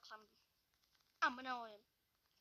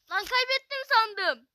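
relieved outburst; 6 semitones higher, 15 dB louder, slower than usual. Real, not acted. Relief after nearly losing round.

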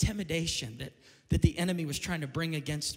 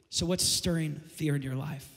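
ordinary delivery

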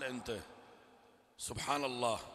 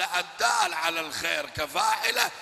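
declaiming; 10 semitones higher, 6 dB louder than usual. Retold or imitated. Preacher declaims the Prophet's saying.

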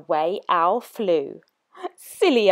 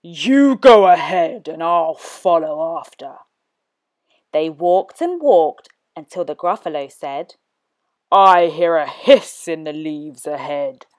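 Gruffalo voice; 4 semitones lower than usual. Acted. Gruffalo threatens the mouse, low and hungry. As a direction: growl it like a hungry beast.

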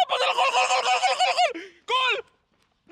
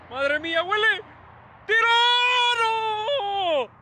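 rising shout into a long high wail; excited yell; 10 semitones higher, 6 dB louder, much slower than usual. Real, not acted. Fan watching a shot on goal miss, excited yell. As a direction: build to a loud, drawn-out cry of dismay.

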